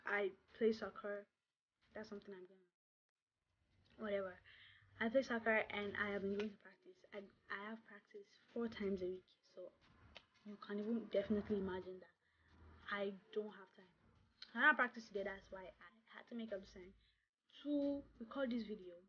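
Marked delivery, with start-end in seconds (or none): none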